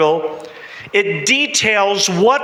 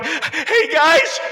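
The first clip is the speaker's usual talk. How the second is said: panicked shouting; 10 semitones higher than usual. Acted. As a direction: shout for help as the boat floods. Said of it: "And he begins to panic".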